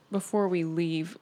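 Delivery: usual speaking voice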